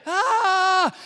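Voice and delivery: loud, high comic wail of panic; terrified scream. Acted. Shepherds interrupting the angel, terrified scream. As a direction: scream right over the speaker.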